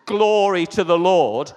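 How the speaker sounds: loud, drawn-out preacher's exclamation; ringing shout of praise. Real, not acted. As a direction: proclaim it big and slow to the congregation. Preacher exclaims praise mid-story.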